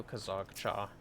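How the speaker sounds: usual speaking voice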